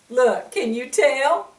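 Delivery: ordinary delivery, nothing marked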